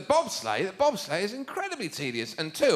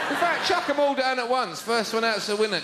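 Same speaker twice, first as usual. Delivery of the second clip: ordinary talk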